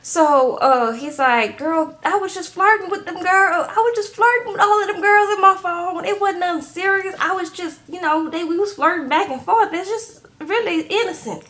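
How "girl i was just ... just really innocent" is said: higher-pitched pleading innocence; lightly hammed-up, high-pitched self-defence; mock excuse-making. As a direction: imitate a man talking his way out of it. Man making excuses, acted in retelling.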